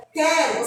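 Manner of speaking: "Quero" said as kero, no u; word quero. Shown as a demonstration. Teacher showing children "Quero" with silent u. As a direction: drop the u in "Quero": ke-ro. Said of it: "Olha só".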